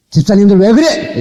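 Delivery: 음을 높여 꾸짖는 시범; 목사답게 높은 소리로 호통치듯. Shown as a demonstration. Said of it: "막 호통도 쳐가면서". (집사들을 나무라는 높은 호통)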